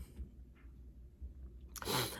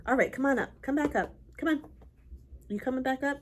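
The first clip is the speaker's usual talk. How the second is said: higher, louder calling; higher, louder coaxing call; calling the dog; 7 semitones higher, 3 dB louder than usual. Real, not acted.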